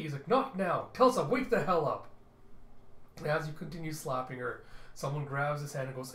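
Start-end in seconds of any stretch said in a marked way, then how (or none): 0.3-2.1 loud, raised, impatient yell; frustrated, forceful voice; exasperated shout. Acted. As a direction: brush off the child and yell. Father snaps, still waking the drunk.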